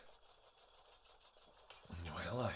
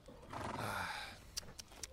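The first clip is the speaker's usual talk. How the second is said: weary sigh; 8 dB quieter than usual. Acted. Exasperated at the donkey.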